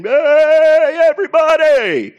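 very high, loud drawn-out holler; hyped-up call over a microphone; announcer rallying a crowd. Acted. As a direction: whoop it to the whole stadium. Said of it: "somebody getting on the microphone and saying".

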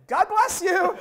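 high, loud, laughing God bless you; laughing cheerful comeback; cheery blessing. Retold or imitated. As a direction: beam it back at the heckler. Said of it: "And I said".

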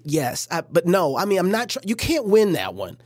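normal talking voice

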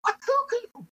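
put-on very high excited little-kid squeal; excited toddler. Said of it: "looks at me and goes".

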